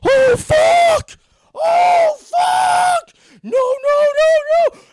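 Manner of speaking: screaming very high and loud like on a roller coaster; panicked high screams. Retold or imitated. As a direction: shriek like you're plunging down a coaster. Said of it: "sounded like he was on a roller coaster".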